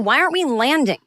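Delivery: high, insistent retold panic; freaking out. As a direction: demand an answer in mock alarm. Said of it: "I am freaking out".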